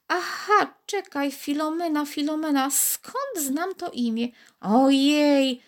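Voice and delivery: high little voice, mildly impatient. A narrator's reading. Bead grows impatient with Karolcia, mild. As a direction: hurry her along.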